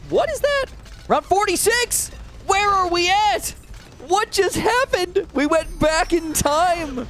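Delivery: very high, slow confused questions; baffled as the round jumps back; high bewildered. Real, not acted.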